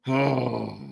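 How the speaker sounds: ordinary delivery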